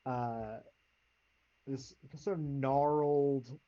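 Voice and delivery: ordinary talk, nothing marked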